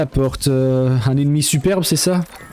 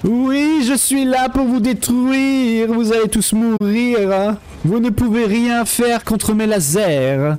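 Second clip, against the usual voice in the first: unhinged villain; 5 semitones higher, slower than usual. Pretend. Plays villain piloting ship.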